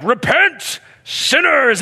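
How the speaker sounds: high, loud mock street preacher yell; angry street preacher. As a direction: ham up a fire-and-brimstone street preacher.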